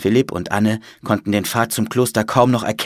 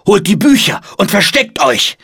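raised, loud commands; urgent call; 10 semitones higher, 6 dB louder than usual. A narrator's reading. Monk orders brothers to save books, urgent shout. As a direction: order everyone about in high alarm. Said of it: "rief er den anderen Mönchen zu".

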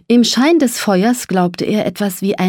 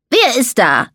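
higher-pitched challenge into the dark; calling out; 5 semitones higher than usual. A narrator's reading. Mole calls to something big nearby, calling out. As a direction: call out as a disturbed mole. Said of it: "rief Samuel".

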